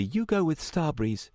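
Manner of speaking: high voice kept level; level, controlled. A narrator's reading. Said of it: "she said levelly".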